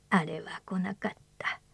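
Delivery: put-on hushed old man, almost whispered; breathy whisper of an old man; creaky wise old man. Old man's lament.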